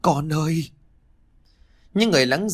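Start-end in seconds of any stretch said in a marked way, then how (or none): 0.0-0.7 whimpering. A narrator's reading. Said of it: "mếu máo nhắc đi nhắc lại".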